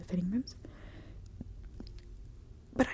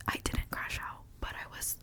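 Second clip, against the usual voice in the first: ordinary talk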